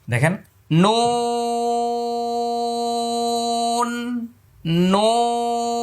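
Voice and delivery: নুন held long and high, lips round then open; drawn out slowly; Arabic: نون ممدود; long nun. Shown as a demonstration. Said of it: "শেষে ফাঁকা হয়ে যাচ্ছে".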